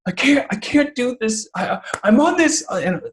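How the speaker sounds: put-on a high, distraught kid voice, close to tears; upset daughter near tears. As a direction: imitate a girl about to cry over her test. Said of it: "almost in the break of tears".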